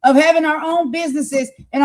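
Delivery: high, heated shouting; real impassioned outburst; letting loose. Real, not acted. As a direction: let rip with indignant force. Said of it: "I have to let loose here".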